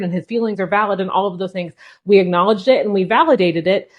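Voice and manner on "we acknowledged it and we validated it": ordinary delivery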